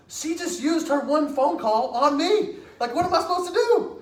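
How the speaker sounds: loud, high-pitched mock disbelief; aghast. Teen to friend after the jail call, aghast. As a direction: reenact a teen helplessly freaking out.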